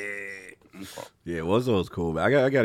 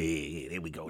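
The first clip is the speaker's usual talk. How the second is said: normal talking voice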